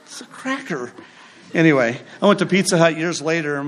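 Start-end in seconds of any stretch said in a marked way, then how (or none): 0.0-0.9 unimpressed deadpan. Retold or imitated. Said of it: "I was like".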